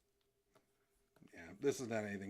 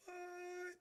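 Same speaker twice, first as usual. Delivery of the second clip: much higher, squeaky disbelief; cartoon shock; much slower than usual. Streamer voicing the shocked cartoon guy, squeaky high. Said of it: "And he's all like".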